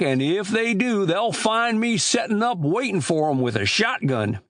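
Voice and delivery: put-on high-pitched rustic old man; old country storekeeper. Old storekeeper grumbling to boys about chicken thieves, high rustic voice.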